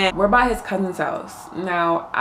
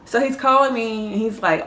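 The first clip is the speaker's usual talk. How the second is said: usual speaking voice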